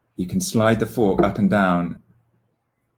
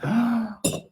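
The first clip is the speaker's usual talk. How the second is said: blowing out hard; 13 semitones higher than usual. Real, not acted. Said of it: "blow as hard as you can".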